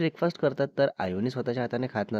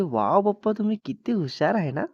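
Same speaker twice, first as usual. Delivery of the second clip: lightly voiced child, higher and admiring; a hint of wide-eyed daughter; impressed little girl; 7 semitones higher than usual. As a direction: gush to papa like an impressed child. Child admiring her clever father.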